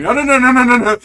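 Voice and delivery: loud, high rushed no's; frantic pleading. Acted. Stefan trying to stop the giant, loud frantic no. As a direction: beg him to stop, voice rising. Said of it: "Stefan starts going".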